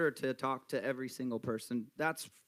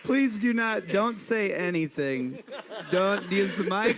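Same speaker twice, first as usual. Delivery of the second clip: laughing plea; 7 semitones higher, 5 dB louder than usual. Real, not acted.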